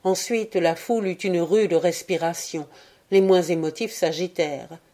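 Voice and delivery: usual speaking voice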